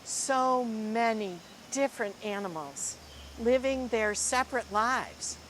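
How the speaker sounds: lively, expressive storybook reading; reading to children. Acted. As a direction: read it like a picture book to a class. Teacher reads picture book aloud.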